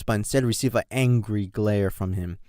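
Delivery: usual speaking voice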